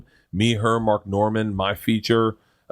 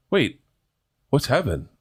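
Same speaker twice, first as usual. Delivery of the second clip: put-on slightly puzzled child, raised pitch; a hint of a confused kid; lightly voiced little girl; 4 semitones higher than usual. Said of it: "And they're like".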